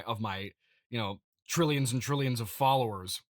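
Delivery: ordinary talk, nothing marked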